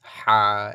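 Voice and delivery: হা long and loud from the middle of the throat. Shown as a demonstration. Teacher shows articulation point, হা from mid-throat. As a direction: push a held হা out from inside the throat. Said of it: "গলার ভেতর থেকে মাঝখানে".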